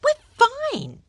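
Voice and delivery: high, loud indignant retort. Acted. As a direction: bristle at being fussed over. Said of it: "looked at him indignantly".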